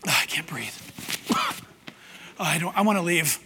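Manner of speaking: low, hushed, choked unease; nervous. Acted. Possessed churchgoer wanting to leave, nervously under the breath. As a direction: murmur it, coughing as if short of air. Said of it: "might get a little nervous".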